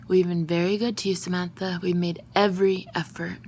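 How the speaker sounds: ordinary delivery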